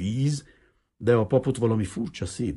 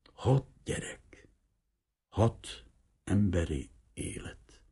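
"Hat gyerek" whispered, slow, voice dropped away; hushed slow "Hat gyerek" repeated to oneself; echoing whisper; 4 dB quieter, slower than usual. A narrator's reading. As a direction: breathe "Hat gyerek" as a haunted echo. Echoed thought, hushed.

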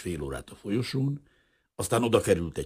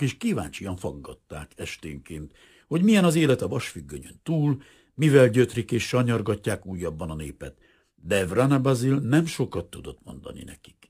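usual speaking voice

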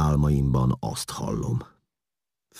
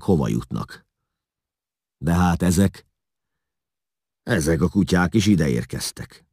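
emphatic ferryman, somewhat raised voice; indignant ferry master; 4 semitones higher, 4 dB louder than usual. A narrator's reading. Ferry master complains about runaway slaves, indignant and emphatic. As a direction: recount your grievance with rough conviction.

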